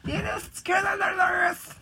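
high, slow, crying mock shout; mock wailing. To friends, mock wailing about [name]. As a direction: wail it like a fake sob.

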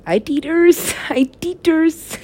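put-on high, slow cooing baby voice; baby talk. Baby-talk about feeding, high and laughing. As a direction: coo it like a baby.

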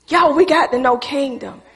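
ordinary talk

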